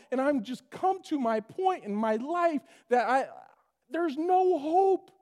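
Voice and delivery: despairing lament. Acted. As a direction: pour out despair as someone at the end. Voicing despairing person.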